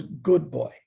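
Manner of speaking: a light hint of the guru's voice; understated approving teacher; guru's praise. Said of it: "And then he goes".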